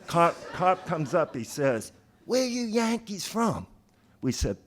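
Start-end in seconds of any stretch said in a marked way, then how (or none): 2.3-3.7 high-pitched mock Southern cop; hammed-up Southern traffic cop voice; bad Southern accent. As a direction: put on a bad Southern drawl to challenge outsiders. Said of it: "worst impression of a Southern accent".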